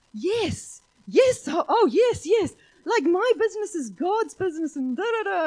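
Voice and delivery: high, loud, elated babble; overjoyed yeses. Retold or imitated. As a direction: burst out with delight. Driver overjoyed, retold.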